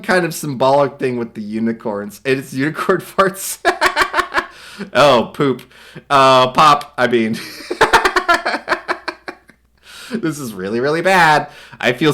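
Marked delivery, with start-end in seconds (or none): none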